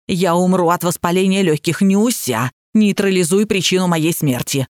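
ordinary delivery

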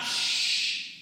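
a hard hiss for silence; sharp shh; loud sharp shush. Acted. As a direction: hush them forcefully. Sleepers complain.

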